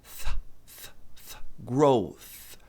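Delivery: unvoiced "th" puffs, then a slow "growth"; drawn-out voiceless "th" at the end of "growth"; isolated TH sound. Shown as a demonstration. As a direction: breathe "th" through tongue and teeth, then "growth". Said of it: "a língua entre os dentes e produz um som de S".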